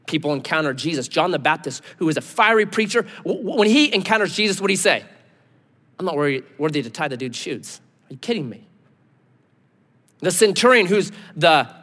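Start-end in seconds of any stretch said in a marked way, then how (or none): 6.0-8.7 low, soft, quick protest; hushed acted unworthiness; humbled disbelief. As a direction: back off in self-deprecation. John humbled before Jesus.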